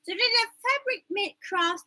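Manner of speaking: high, mocking wrong take; mock sloppy version. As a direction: parody the mumbled product name. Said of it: "No, no, no, no, no".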